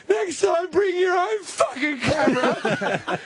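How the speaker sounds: high, slow laughing mock shout; tearful yell. Girlfriend storming off in tears, high mock shout. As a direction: scream it back through mock tears. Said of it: "she bursts into tears".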